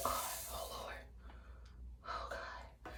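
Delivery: very soft muttered prayer; nervous whisper. Real, not acted. Vlogger about to use hot brush, scared hushed whisper. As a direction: barely breathe it in dread.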